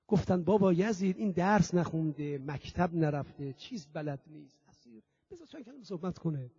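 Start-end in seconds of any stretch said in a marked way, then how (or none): none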